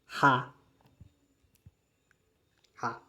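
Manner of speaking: "Rá" with a clean breathy h, soft; German letter H. Shown as a demonstration. Teacher naming the German letter H. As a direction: say "Rá" with a clean h, not guttural. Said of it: "O H é o Rá".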